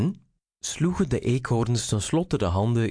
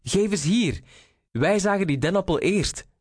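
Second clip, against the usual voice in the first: high, insistent storybook call; 9 semitones higher than usual. A narrator's reading. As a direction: demand it back in a high voice. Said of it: "riep Reinier". (hoog en dwingend geroepen)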